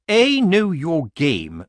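slow, high, loud accusation; accusing committee woman. A narrator's reading. Committee woman confronting Granny, accusing.

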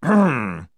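loud, meaningful ahem; loud throat-clearing. A narrator's reading. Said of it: "said the General loudly".

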